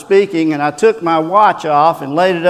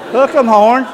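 Texas cheer; 7 semitones higher, 4 dB louder than usual. Pretend.

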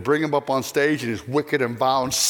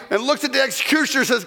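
ordinary delivery

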